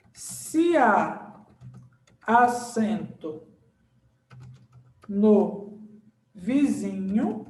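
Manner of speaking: very slow, word by word, long pauses; slow dictation. Shown as a demonstration. Teacher writing the accent rhyme for students, slow dictation. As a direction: dictate the rule while writing it out.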